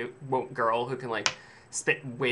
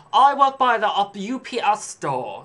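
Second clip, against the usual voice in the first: high, loud, slow put-on snob, U P S as letters; mock a haughty clerk spelling out U P S; snobby saleswoman; 8 semitones higher, 8 dB louder, slower than usual. Haughty clerk boasting, saying U P S letter by letter. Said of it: "you're a stuck-up snob working in a clothing store".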